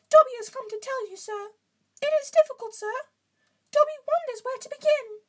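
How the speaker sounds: earnest, very high loud elf; Dobby. A narrator's reading. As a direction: squeak it earnestly in falsetto. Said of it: "said Dobby earnestly".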